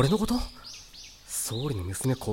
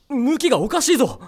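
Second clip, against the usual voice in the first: ordinary delivery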